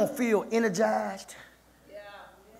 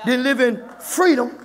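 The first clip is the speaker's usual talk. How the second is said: raised, loud preacher's shout; fired-up preaching; 5 semitones higher, 6 dB louder than usual. Real, not acted. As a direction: punch it out to the congregation. Preacher drives the point home.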